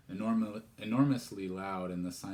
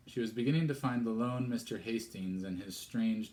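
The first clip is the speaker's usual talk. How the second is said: plain reading voice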